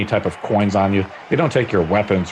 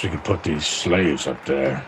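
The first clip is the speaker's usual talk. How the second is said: orc commander grumble; 5 semitones lower, 4 dB quieter than usual. Acted. GM voices Commander Dench.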